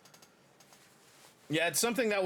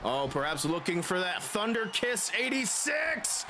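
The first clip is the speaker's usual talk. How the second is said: screaming finish call, much higher; wrestling commentator; 13 semitones higher than usual. Acted. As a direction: shout the big title-win call. Match finish in game commentary.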